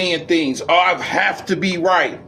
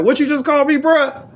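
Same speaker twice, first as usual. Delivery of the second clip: mock-offended confrontation; confronting challenge; 10 semitones higher than usual.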